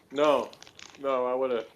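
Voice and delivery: ordinary talk, nothing marked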